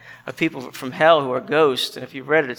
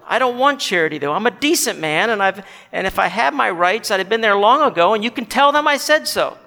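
higher self-righteous protest; proud, indignant ghost; 4 semitones higher, 4 dB louder than usual. Acted. Decent man's ghost refuses charity, proud and indignant. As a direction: insist on your decency, pitch climbing.

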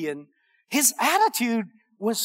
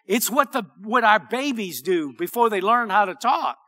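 ordinary talk, nothing marked

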